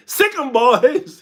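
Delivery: loud, high, drawn-out order; mock attack command. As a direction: shout it like setting the dogs on him. Delilah sets the Philistines on Samson.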